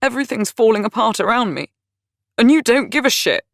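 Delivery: rant, everything's falling apart; angry rant. A narrator's reading. Dudley ranting at Lizzie about lost jobs, angry and loud.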